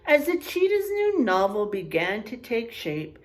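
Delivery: ordinary talk, nothing marked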